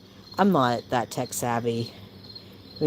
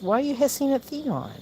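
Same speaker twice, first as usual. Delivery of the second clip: high gentle scold to a pet; pet talk; 11 semitones higher than usual. Real, not acted. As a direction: chide the cat sweetly.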